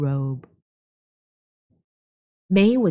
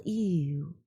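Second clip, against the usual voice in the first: disgusted ew; 9 dB quieter than usual. Acted. Smelling Nian's terrible smell.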